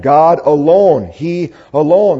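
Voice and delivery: "alone" drawn out slowly and firmly; stress on alone. Shown as a demonstration. Preacher showing the psalm's emphasis, stress on "alone". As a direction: lean on the word "alone" each time. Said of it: "the emphasis on the word alone".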